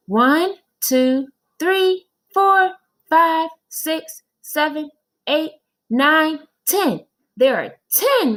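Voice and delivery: put-on high kid voice counting aloud; child Joe counting. Boy repeats the count for his teacher, high kid voice. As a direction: count like a little boy reciting.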